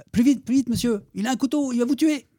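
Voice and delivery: put-on a little boy yelling a warning while running; voicing the boy. Teacher voicing the nine-year-old boy warning him, high and urgent.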